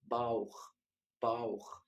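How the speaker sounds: باوخ said slowly as German Bauch, ending in a throaty kh; teacher modelling باوخ as Bauch; German pronunciation. Shown as a demonstration. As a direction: stretch باوخ and close on kh. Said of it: "كلمة معدة أو بطن اسمها".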